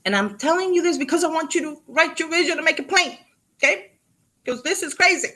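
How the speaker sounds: building to the big reveal; excited. Real, not acted. Speaker worked up about story.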